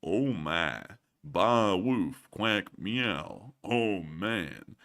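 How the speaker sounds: halting word-by-word reading, low and slow; learning to read. Real, not acted. Host reading a viewer's nonsense message, like a beginning reader. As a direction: sound out each word with gaps between. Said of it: "like I was just learning how to read".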